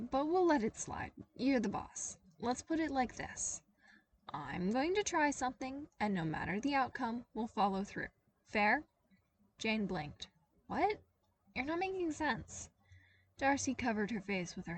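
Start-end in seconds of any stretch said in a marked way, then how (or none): 0.1-8.8 wry bargaining. A narrator's reading. Intern negotiating with boss. 10.7-12.7 high, startled confusion; the boss, baffled, pushes back at the intern. A narrator's reading.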